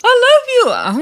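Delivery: very high-pitched mock declaration; mock pleading. Mocking Krishan while she grabs her sister, high squeal. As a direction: squeal it like a clingy kid.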